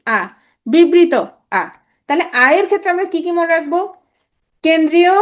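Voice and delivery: usual speaking voice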